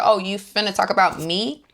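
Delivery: Brittany snapping back at Meredith with sass; sassy clapback. Retold or imitated. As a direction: throw the shade back at her. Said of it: "So Brittany was like".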